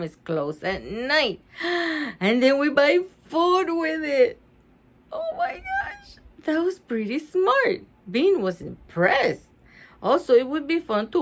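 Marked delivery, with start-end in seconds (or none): 5.1-6.2 very high, slow amazed cry; amazed exclamation. Real, not acted. Reader amazed by Ivy's plan, high slow squeal.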